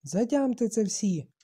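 high, loud, slow warning; piercing shriek. A narrator's reading. Boy warning the whole assembly, shrill and loud. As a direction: drive it home at a squeal. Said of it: "голос трохи пронизливо заверещав".

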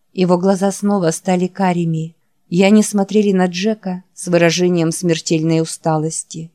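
ordinary delivery

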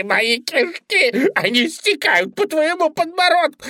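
high, loud wailing; agonised rabbit. A narrator's reading. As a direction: shriek in torment and beg for death. Bunny disembowelled, begs to die.

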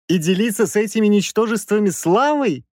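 high-voiced professor's scorn; contemptuous. A narrator's reading. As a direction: sneer at the idea, pitched high. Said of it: "презрительно скривился профессор".

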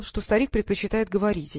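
ordinary delivery

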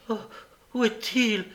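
high, bewildered cry; distraught cry. A narrator's reading. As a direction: cry out, lost and frantic. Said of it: "s'écria Nancy d'un air égaré".

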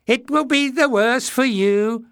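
high, loud, mocking squeaky echo; mocking mimicry. A narrator's reading. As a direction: throw his words back in a silly high voice. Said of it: "mimicked one".

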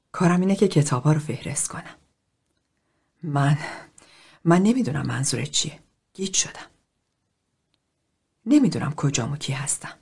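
on the verge of tears. A narrator's reading. Librarian Bonnie admits to the group she's lost, near tears. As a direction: Bonnie barely holding back tears. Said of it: "بانی شروع کرد به گریه کردن".

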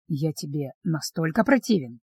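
low, soft, stung question; vampire asks the heroine softly, hurt; hurt quiet question. Acted. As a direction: ask it quietly, wounded.

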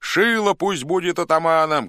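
high, loud rallying cry; crowd shout. A narrator's reading. Some Cossacks nominate their man, shouting. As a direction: shout for your candidate. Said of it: "кричали одни".

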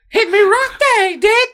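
very high falsetto British granny; hammed-up shrill old lady. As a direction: wail like a mugged English granny on the news.

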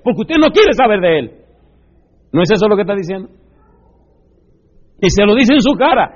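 preaching. Acted. Preacher rebuking the congregation, loud and rising. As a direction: drive it home to the congregation. Preacher builds to full force.

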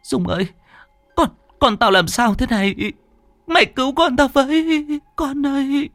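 high, tearful wailing plea; whimpering plea. Acted. Terrified mother begging her friend to save her son, tearful high wail. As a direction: beg through near-sobs. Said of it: "ôm con mếu máo".

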